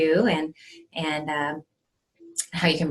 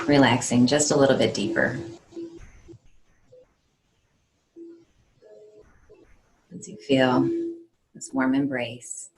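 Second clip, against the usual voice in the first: measured meditation voice with long pauses; guided meditation; 2 semitones lower than usual. Acted. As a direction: guide them calmly, phrase by phrase.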